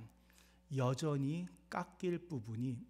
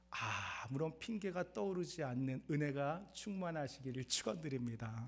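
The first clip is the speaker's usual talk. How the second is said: pastoral blessing; 2 semitones lower, 7 dB quieter than usual. Real, not acted. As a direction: let the blessing settle gently. Preacher softly blesses congregation.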